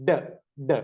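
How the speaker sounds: short isolated "ഡ", not the letter name; letter D sound. Shown as a demonstration. Teacher answering which sound begins dog, short separate "ഡ". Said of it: "ഡിയുടെ sound".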